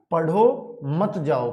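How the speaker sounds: slow पढ़ो, then मत जाओ grouped together; pause after पढ़ो. Shown as a demonstration. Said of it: "पापा ने ये बोला था ना".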